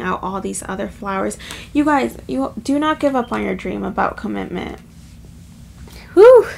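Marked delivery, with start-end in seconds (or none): 6.1-6.5 sharp, high, loud whew; emotional whew. Real, not acted. Reader overwhelmed by emotion, releasing a loud whew. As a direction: let out a big emotional whew. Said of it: "Okay, sorry".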